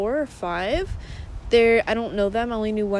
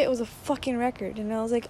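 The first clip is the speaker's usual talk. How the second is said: normal talking voice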